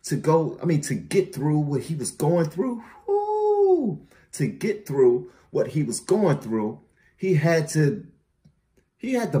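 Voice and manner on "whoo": high hype shout; excited whoo. Real, not acted. Hyped by the rapper's bars, a high whoop.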